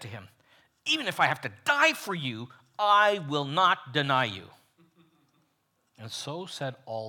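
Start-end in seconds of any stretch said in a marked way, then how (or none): 0.8-4.5 emphatic vow. Acted. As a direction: swear it with full force. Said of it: "Just as emphatically he said".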